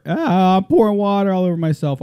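real yelp of surprise, high and loud; startled by spill. Real, not acted. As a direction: break off, caught out by a sudden spill.

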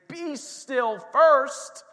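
Be still first said very slowly and loud, first falling hard; stress on first. Shown as a demonstration. Pastor correcting the church's order: Be still first. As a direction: lean on the word first. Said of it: "what this verse is saying".

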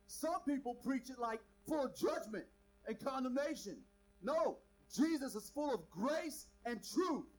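loud, driving sermon voice; full-force preacher; preaching. Acted. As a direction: preach it out to the crowd.

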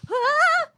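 sudden high-pitched cry; mock alarm yelp; high startled yelp. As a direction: yelp as the cup flies. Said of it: "I'll be like".

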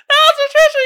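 very high, loud squeal; cracked-up comic shriek; hysterical shriek. Pretend. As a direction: scream it in giddy hilarity. Repeats punchline, cracking up.

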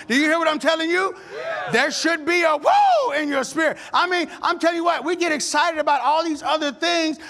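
rising, punchy church exhortation; rally the congregation to worship; fired-up preaching. Acted. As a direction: pastor preaching at full force.

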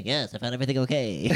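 monotone store clerk voice; flat rote cashier. Pretend. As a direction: recite it like a bored cashier. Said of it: "I would just make a voice".

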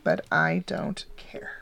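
slow whispered shrug; whispered aside. Real, not acted. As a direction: whisper it, not caring. Dismissive aside.

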